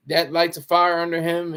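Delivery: ordinary talk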